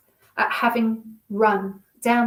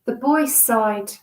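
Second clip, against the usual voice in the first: ordinary delivery, nothing marked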